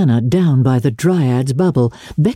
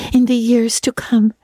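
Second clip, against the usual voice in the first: put-on higher girlish voice, theatrically solemn; solemn, affecting; 7 semitones higher than usual. A narrator's reading. Anne asks parting Diana for a lock of hair, solemn. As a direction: recite it like a romantic young heroine. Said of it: "Anne's affecting accents".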